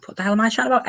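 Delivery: high-pitched self-correcting disbelief; baffled at own slip. Real, not acted. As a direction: catch your own mistake, voice jumping up. Catches own slip about season ten.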